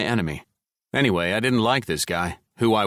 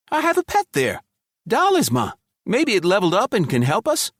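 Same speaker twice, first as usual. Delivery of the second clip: much higher, louder, bursting with eager hope; eager excitement; 13 semitones higher, 4 dB louder than usual. Acted. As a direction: Malik suddenly remembering his pet, high and eager. Malik excitedly remembering his pet.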